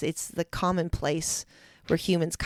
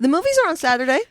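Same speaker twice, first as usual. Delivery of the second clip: higher mock Australian lilt with upward inflection; Australian statement question; 9 semitones higher, 8 dB louder, faster than usual. Teasing an Australian about statement questions, mock upward inflection. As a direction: tease the Aussie rising tone. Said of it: "upward inflection".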